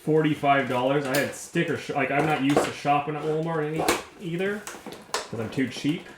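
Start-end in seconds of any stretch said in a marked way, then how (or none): none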